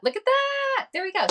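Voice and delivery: high, bright burst of delight at the screen; excited squeal. Real, not acted. Host delighted as the award pops up on screen, squealing high. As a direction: squeal with glee as it appears.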